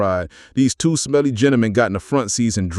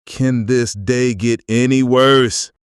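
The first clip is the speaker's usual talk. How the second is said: slow, drawn-out exasperated sigh of a question; weary exasperation; slower than usual. Acted. Narrator getting pulled over, slow and exasperated. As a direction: drag it out like the day has beaten you.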